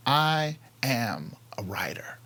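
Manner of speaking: slow, word-by-word declaration; declaring with conviction. Retold or imitated. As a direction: say it slowly, weighting each word, softening at the end. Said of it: "You gotta say it out loud".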